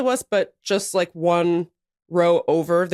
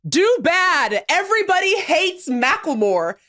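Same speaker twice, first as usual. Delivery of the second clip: high, jeering sing-out; playful high-pitched taunt from the stands; retold heckle; 8 semitones higher, slower than usual. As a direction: imitate a rival fan heckling. Imitating a rival fan's heckle.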